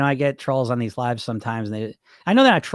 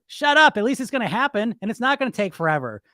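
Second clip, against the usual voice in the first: mock stern; 8 semitones higher than usual. Shutting down the bully.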